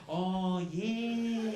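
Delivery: high, loud, long-drawn Australian lure; Australian siren call. Retold or imitated. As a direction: lure them in like a mermaid, Australian accent. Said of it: "this cool accent was like a siren call".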